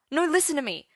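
high, rushed panic; frantic worried teen; panicked. Acted. Arthur panicking about his father.

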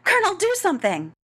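high, loud, fast plea; rushed, high-pitched cry of alarm; panicked yelp. A narrator's reading. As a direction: beg frantically for rescue. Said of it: "Havoc yelped".

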